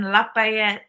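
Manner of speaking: "Lafayette" said with a p for the f; Filipina coworker's pronunciation. Retold or imitated. Quoting a Filipina coworker, "Lafayette" pronounced "La-pie-et". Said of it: "that's how she said it".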